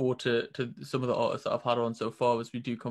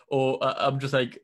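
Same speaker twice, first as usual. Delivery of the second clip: ordinary talk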